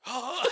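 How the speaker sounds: loud high-pitched scream then laughter; retold scream. Retelling the underwater find, a loud scream. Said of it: "and I scream underwater".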